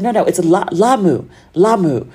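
"Lamu" repeated clearly with a broad first "ah"; kindly correcting pronunciation. Retold or imitated. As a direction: gently model "Lamu" as LAH-moo. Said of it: "she would correct me very kindly".

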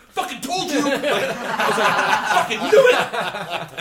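full-volume, high-pitched shout of vindication; triumphant yelling. Retold or imitated. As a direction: yell it like you've just been proven right. Acting out his vindicated outburst on stage.

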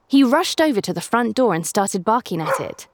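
ordinary delivery, nothing marked

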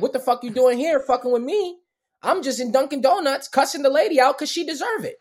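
retold angry yell. Drunk man ranting at the cop who came, loud and angry. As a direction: blow up at the cop. Yelling at cops again.

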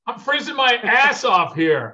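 high, loud, slow griping; exaggerated complaint. Real, not acted. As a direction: ham up being freezing. Complaining about the cold.

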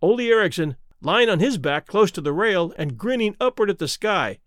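usual speaking voice

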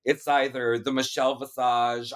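ordinary delivery

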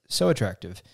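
usual speaking voice

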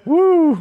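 loud, very high "woo"; high whoop. Real, not acted. Reacting to a brutal nickname, high whoop. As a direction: whoop in amused shock.

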